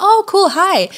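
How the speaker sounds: high, eager greeting; friendly excitement. Retold or imitated. Traveller greeting couple from L A, excited.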